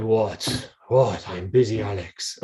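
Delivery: put-on impatient German coach, deep accent. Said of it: "there's a deep German accent".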